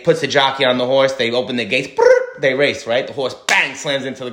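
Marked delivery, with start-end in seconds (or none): 1.9-2.4 mock starting-gate brrr. Gates open in horse race.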